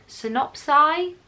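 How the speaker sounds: slow, tentative question; unsure. Real, not acted. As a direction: try out the plural, doubting it. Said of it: "I don't know".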